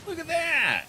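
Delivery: loud, high excited cry; excited shout. Real, not acted. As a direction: shout it out in delight. Excited at game event.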